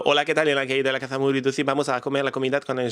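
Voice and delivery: rattled-off gibberish in a fake Spanish accent, fast; mock a Spanish tourist with a Spanish accent. Vlogger mocking Spanish tourists, in a fake Spanish accent. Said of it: "je peux faire l'accent".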